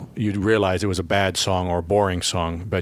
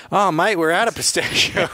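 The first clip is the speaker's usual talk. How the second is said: much higher mock Australian shopkeeper; Australian accent; 9 semitones higher than usual. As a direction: imitate the Aussie ice cream guy. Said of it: "He goes".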